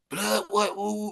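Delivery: lightly mocked gangbanger, pitched high; gangbanger in character. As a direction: put on a high gang-member voice. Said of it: "get them out their character to start saying".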